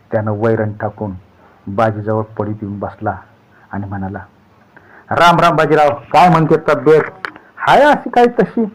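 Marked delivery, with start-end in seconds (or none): 5.1-8.6 much higher, louder village greeting; hearty greeting. A narrator's reading. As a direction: greet the sick friend loudly and brightly. Visitor greets sick man. (आजारी बाजीला मोठ्याने राम राम करणारा भिवा)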